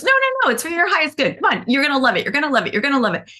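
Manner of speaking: high, eager sing-song coaxing; over-keen healer talking a friend round; pushy coaxing. Retold or imitated. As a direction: wheedle her into the massage, voice up high.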